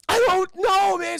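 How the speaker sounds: high, loud mock panic; panicked yell. Cuffed jumper answering the cop, high panicked yell. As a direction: blurt it out at full pitch.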